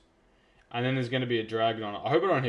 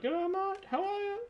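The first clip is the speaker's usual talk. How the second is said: squeaky high cartoon voice; Simon's greeting. Player voicing mob Simon greeting him, squeaky high cartoon voice. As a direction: squeak a cheery hello as a tiny critter. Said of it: "He's like".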